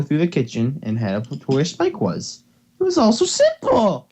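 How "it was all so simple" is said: much higher, bright sing-song read; reading the hopeful line in a high, hammed-up voice; mock cheerful. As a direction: overplay the optimism. Reads line as cheery character.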